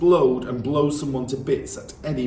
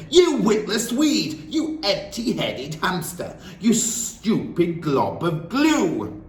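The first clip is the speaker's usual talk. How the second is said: loud, slow, high bellow; 9 semitones higher, 4 dB louder, slower than usual. Acted. Headmistress bellowing insults at the boy, loud and slow. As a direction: spit the insult out slowly.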